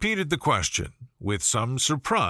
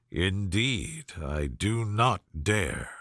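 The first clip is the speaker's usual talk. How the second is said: lower, slow and soft, gallant gentleman; Darcy's gallant reply; 7 semitones lower, 4 dB quieter, slower than usual. Acted. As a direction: answer her gently, deep and unhurried.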